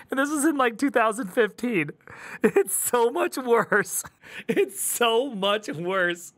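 slow, drawn-out words through laughter; real laughing exasperation; laughing emphasis. Real, not acted. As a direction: stretch it out, laughing at how bad it is. Laughing at how bad it got.

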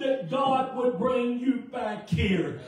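high, slow, loud retold plea; imitated tearful cry. Woman tells preacher of months of prayer, imitated high crying plea. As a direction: stretch it out as an imitated impassioned cry. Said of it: "She's crying like a baby".

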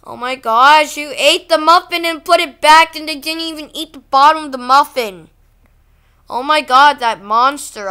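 outraged. Real, not acted. Vlogger reacting to a half-eaten muffin, loud outrage. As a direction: blurt it out loud, shocked and appalled.

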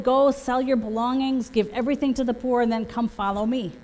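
normal talking voice

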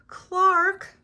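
loud, high shout of dismay; acted outraged cry. A narrator's reading. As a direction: shout the name high, as an upset friend. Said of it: "cried Joey".

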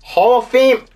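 ordinary delivery

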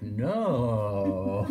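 low, breathy, seductive no; mock-seductive, with a laugh; sexy voice. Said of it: "like, a sexy voice".

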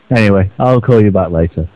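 ordinary delivery

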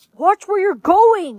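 high, drawn-out complaint; annoyed scolding. Acted. Passer-by bumped by a girl, annoyed. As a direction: scold with indignant high pitch.

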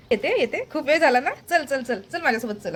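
acted-out hurried summons; eager calling. Calling someone along, voiced quickly.

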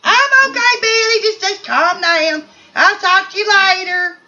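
high-pitched cry, then insistent reassurance; high put-on Edwina. Edwina telling Billy to calm down, high insistent voice. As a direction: call out high, fending off worry.

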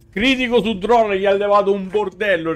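normal talking voice

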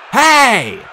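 loud, high shout; yelled challenge. A narrator's reading. Hero distracting a hellhound, loud high yell. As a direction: yell to draw the beast's attention. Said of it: "I yelled".

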